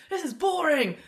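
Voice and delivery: high, loud heckling; impatient shouts from the stands; booing crowd. Acted. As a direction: heckle like a bored spectator. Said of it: "you get the people going".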